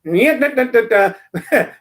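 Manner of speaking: mock cartoon jingle sung in nonsense syllables; vocal imitation of a cartoon fanfare; cartoon theme. Host mocking a cartoon-voiced justice, sung jingle imitation. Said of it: "Warner Brothers cartoon voice".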